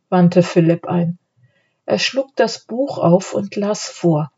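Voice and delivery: usual speaking voice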